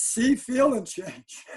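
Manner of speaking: see, feel, and change said slowly with weight; slow, spaced-out list. Shown as a demonstration. Coach correcting the old model, slow and deliberate. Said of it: "No, it isn't".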